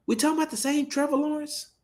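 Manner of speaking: very high, quick incredulous question; squeaky disbelief. Real, not acted. Host asks Tay which quarterback is meant, incredulous. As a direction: ask it way up high, baffled. Challenges co-host over analyst's claim.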